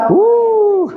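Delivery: high, loud, drawn-out woo; real whoop on the ice; slippery-floor whoop. Real, not acted. Traveller on very slippy ice, high loud whoop.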